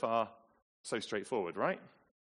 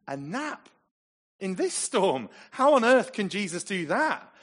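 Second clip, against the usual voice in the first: high, loud disbelieving questions, building; incredulous outrage; 8 semitones higher, 8 dB louder than usual. Acted. Preacher voicing disciples' disbelief at Jesus napping, incredulous.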